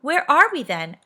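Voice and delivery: alarmed. A narrator's reading. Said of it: "said Bessie, alarmed".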